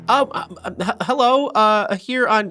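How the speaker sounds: high, stammering, nervous greeting; flustered receptionist. Acted. Young receptionist startled by the sheriff barging in, high flustered stammer. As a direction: trip over the words like a startled young clerk. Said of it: "She goes".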